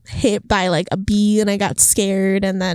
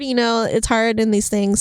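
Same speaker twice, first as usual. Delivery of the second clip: usual speaking voice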